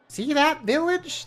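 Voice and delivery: very high, slow, chirpy little fairy voice; chirping creature. Acted. Tiny winged creature greets the traveller, high and chirpy. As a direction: squeak it out like a tiny bird-sized sprite. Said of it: "the creature replies".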